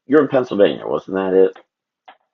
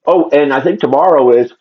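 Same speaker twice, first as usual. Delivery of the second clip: yawning; 8 semitones higher, 8 dB louder than usual. Real, not acted. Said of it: "I'm yawning".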